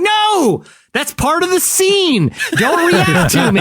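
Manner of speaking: high, indignant actor's protest; frantic actor. Retold or imitated. Retelling the David Cross sketch, high indignant actor. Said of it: "he's like".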